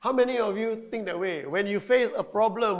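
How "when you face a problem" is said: ordinary talk, nothing marked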